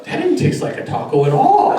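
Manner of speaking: loud comic complaint with a laugh; mock dismay. Therapist after eating Play-Doh taco, loud mock dismay. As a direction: blurt it loudly, playing up the disappointment.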